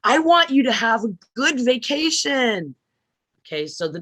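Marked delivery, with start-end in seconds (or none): 0.0-2.8 higher, brighter, changed tone; the same wish with a lifted, altered tone; different tone. Shown as a demonstration. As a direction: say it in a clearly different tone. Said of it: "is different than".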